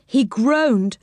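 ordinary delivery, nothing marked

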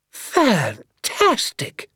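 high, hugely stretched exclamation; thrilled admiration. Acted. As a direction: draw the word out in high delight. Doctor thrilled by the scheme.

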